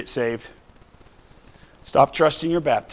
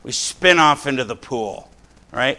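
ordinary talk, nothing marked

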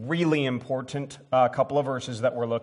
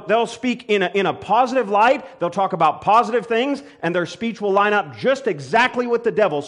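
higher, louder fervent sermon delivery; preaching at full force; 5 semitones higher, 6 dB louder than usual. Real, not acted. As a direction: build it up and preach it out.

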